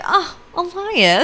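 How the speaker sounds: high, slow, swooning oh; swooning laugh. Real, not acted. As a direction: melt over the name like a fan. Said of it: "And I was like".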